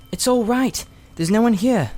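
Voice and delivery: put-on high girlish voice, hushed and careful; a young girl reassuring her friend; Polly, a young girl. A narrator's reading. Polly's voice in the story.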